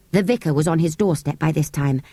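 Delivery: plain reading voice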